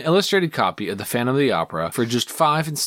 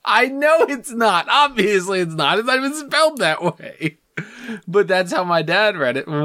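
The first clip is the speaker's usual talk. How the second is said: exasperated protest; 9 semitones higher than usual. Real, not acted. Defending dad's odd pronunciation, high exasperated whine. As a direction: protest way up high, stretching the words.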